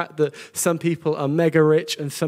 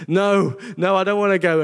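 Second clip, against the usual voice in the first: higher, louder reluctant protest; a mock-petulant no, no, raised and loud; whiny Moses; 4 semitones higher, 5 dB louder than usual. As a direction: play Moses refusing like a sulky kid. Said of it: "Moses is like".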